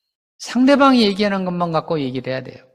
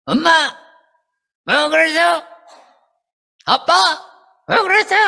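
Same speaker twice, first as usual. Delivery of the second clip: put-on child flaring up; 12 semitones higher than usual. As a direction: snap back at mom and dad in a high voice. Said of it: "그때부터 승질 내요".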